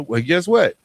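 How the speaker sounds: ordinary delivery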